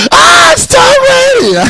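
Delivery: very high, drawn-out shrieky scream; fan scream. Retold or imitated. Man meeting Tom Brady, high fan scream. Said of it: "I screamed".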